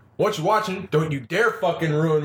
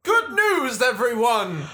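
high, hammed-up announcer cry; theatrical high-pitched proclamation; put-on catchphrase voice; 10 semitones higher, slower than usual. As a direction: pitch it way up like a cartoon greeting. Group riffs show catchphrase in put-on voice.

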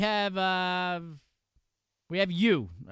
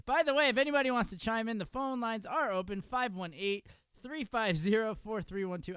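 call-in announcer read; 6 semitones higher than usual. Acted. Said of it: "announcing the call-in number".

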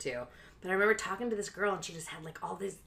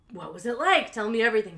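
louder, higher eager plea; eager curiosity; 5 semitones higher, 9 dB louder than usual. Retold or imitated. She begs the soccer mom for details, loud eager plea. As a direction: lean in hungry for gossip. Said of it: "I was like".

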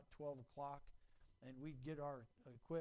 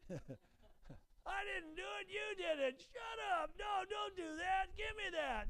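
put-on much higher, shouting childish bickering; kids squabbling; 5 dB louder, slower than usual. Preacher playing quarrelling kids, high shouting squabble. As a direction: squeal and yell like siblings fighting.

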